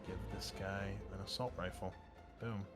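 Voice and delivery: usual speaking voice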